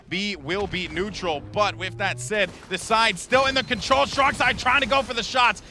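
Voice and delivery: rising into high, excited shoutcasting; live play-by-play. Real, not acted. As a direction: build the call as the fight heats up.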